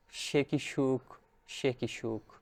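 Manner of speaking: soft, slow savouring of a happy memory; hushed bliss. A narrator's reading. Narrator recalling the moon following the night train, hushed bliss. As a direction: linger softly on each word, letting it fade.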